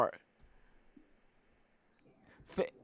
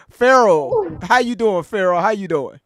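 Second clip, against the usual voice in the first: calling out; 3 semitones higher, 8 dB louder than usual. Real, not acted. Calling a guest on stage.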